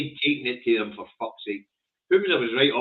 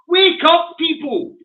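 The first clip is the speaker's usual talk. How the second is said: loud, high-pitched call to wake up; rallying shout; 8 dB louder, slower than usual. Real, not acted. Host rouses listeners against state smears, rallying shout.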